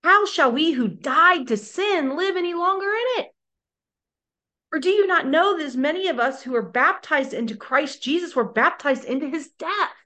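emphatic Paul. Retold or imitated. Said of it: "he has an explanation point there".

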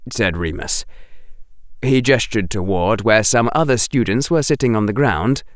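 ordinary delivery

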